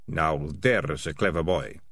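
low, slow, admiring praise. Acted. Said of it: "said Ratcatcher One admiringly".

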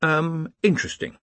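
lightly imitated evasive pause; hesitant euphemism. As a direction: echo someone choosing a tactful word. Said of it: "That was how he had put it".

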